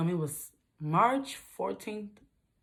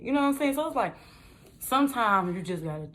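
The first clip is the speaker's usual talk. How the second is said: ordinary talk, nothing marked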